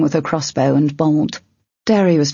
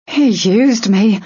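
wounded, raised voice, slow; hurt; 5 semitones higher, 4 dB louder, slower than usual. A narrator's reading. Diz tells Cale of being used, hurt. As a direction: let the hurt through, drawn out slowly. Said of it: "Cale heard the hurt".

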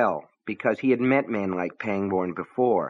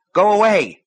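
shouts high and loud, agitated; angry shout; 10 semitones higher, 7 dB louder than usual. A narrator's reading. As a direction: bark it out through the door. Husband yells through locked door.

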